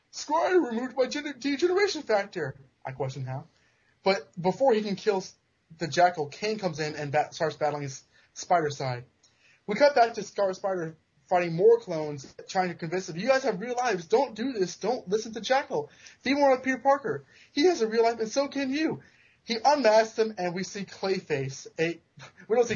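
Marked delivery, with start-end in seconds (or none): 0.1-2.5 villain voice. Retold or imitated. Jackal voiced in retold comic. 13.2-19.0 earnest pleading. Retold or imitated. Scar Spider pleading with clones.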